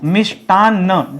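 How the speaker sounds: मिष्टान्न drawn out slowly, holding the doubled न्न; slow spelling demo. Shown as a demonstration. Teacher slowly repeating the word for students. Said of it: "double न रहेगा".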